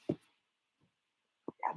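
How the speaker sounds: breathy whispered yeah. Real, not acted. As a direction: whisper it under your breath. Whispered aside.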